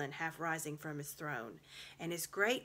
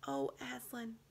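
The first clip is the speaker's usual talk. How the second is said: whisper; 5 semitones higher than usual. A narrator's reading. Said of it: "whispered Susan in the lion's ear".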